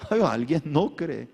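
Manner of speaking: ordinary talk, nothing marked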